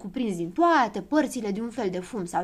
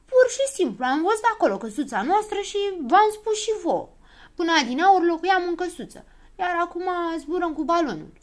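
high, loud, offended protest; indignant; 8 semitones higher, 5 dB louder than usual. A narrator's reading. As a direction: defend yourself in a huff. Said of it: "se supără Habar n-am".

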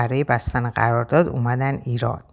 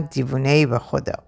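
ordinary delivery

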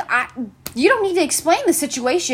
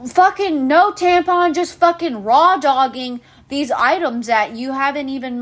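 ordinary talk, nothing marked

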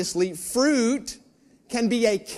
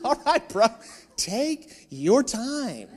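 sarcastic drawl; 3 semitones higher, slower than usual. Retold or imitated. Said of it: "I was like".

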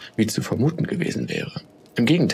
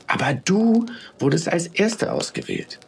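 high, mildly shocked protest; appalled; 7 semitones higher than usual. A narrator's reading. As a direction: protest in dismay, pitch lifted. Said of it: "Eine ältere Flocke wirkte aufrichtig entsetzt".

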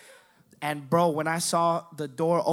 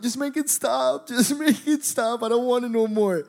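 high, whining wail; mock tearful pleading; 6 semitones higher than usual. High teen being consoled by friends, wailing mock plea. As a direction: beg like a bawling kid.

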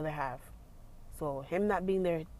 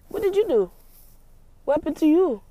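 incredulous questions; 10 semitones higher, 10 dB louder, faster than usual. Retold or imitated. Mocking indignant questions.

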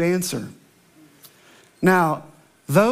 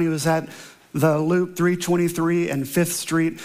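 ordinary talk, nothing marked

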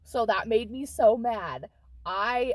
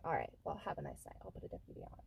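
bored mumble; 7 semitones lower, 15 dB quieter, much faster than usual. Retold or imitated. Officer brushing her off.

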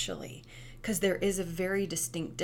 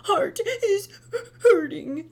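put-on much higher, loud sobbing little girl; crying child; 13 semitones higher, 8 dB louder, slower than usual. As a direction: wail it through tears like a two-year-old. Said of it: "through her tears".

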